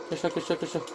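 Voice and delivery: hushed urgent self-talk; whispering while hiding. Real, not acted. Hiding from the monster. (chuchoter en se cachant)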